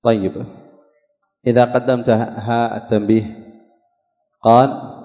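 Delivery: ordinary talk, nothing marked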